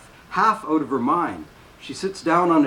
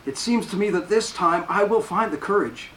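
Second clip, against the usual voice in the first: put-on a higher, trembling-edged young nun; a hint of fragile resolve; lightly voiced Blanche; 6 semitones higher than usual. A narrator's reading. Blanche, frightened, hopes for courage.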